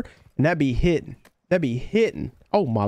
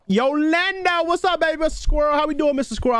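high-pitched, sing-out greeting; excited stream hello, pitched way up; hyped shout-out; 8 semitones higher than usual. Real, not acted. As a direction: holler a big welcome to a viewer. Shout-out to arriving viewer.

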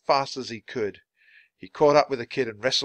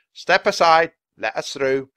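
loud, raised order, easing slightly; yelling; 6 semitones higher, 8 dB louder than usual. Acted. Policeman to the crowd, yelling an order. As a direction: clear a path with your voice. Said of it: "he yelled at the crowd".

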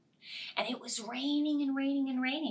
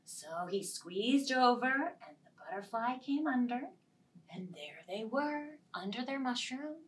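usual speaking voice

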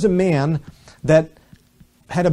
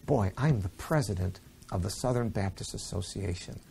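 proud inner thought; 6 semitones lower, 9 dB quieter than usual. Retold or imitated. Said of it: "I was thinking".

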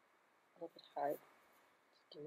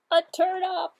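duck voice; 10 semitones higher, 9 dB louder than usual. Acted. Duck's guess.

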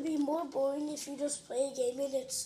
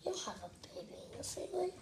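whispering; 2 semitones higher, 7 dB quieter, faster than usual. Real, not acted. Teasing a friend in a whisper.

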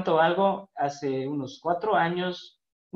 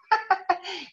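laugh. Real, not acted. Laughing at retold teasing.

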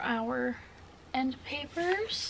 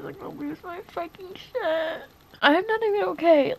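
high, loud teary wail; half laughing, half crying over a book; 6 semitones higher, 6 dB louder than usual. Real, not acted. Reader overwhelmed by the story, real crying. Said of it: "why am I crying?".

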